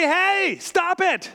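high, slow call of a stern parent; parent catching kid. Retold or imitated. Parent calling to a child drawing on the wall, high and stern. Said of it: "And you say".

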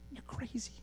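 hushed, high-pitched disbelief; mock-incredulous, very soft. Jesus's imagined shocked reply to Satan.

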